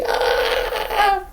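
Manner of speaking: high mock scream. Woman tased in the arm, high mock scream. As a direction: let out a high shriek.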